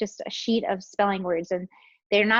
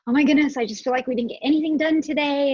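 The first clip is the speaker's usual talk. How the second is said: higher, louder venting on a call; retold exasperation; 5 semitones higher, 4 dB louder, faster than usual. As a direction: re-enact a mildly overwhelmed complaint. Retelling her distressed phone call.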